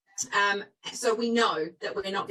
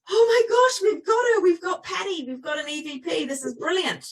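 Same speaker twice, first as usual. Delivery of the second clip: high, loud rush of delight easing down; retold excitement; 8 semitones higher, 3 dB louder than usual. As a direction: re-enact a thrilled ghost-hunting discovery. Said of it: "when I got quite excited".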